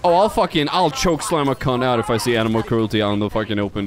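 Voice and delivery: usual speaking voice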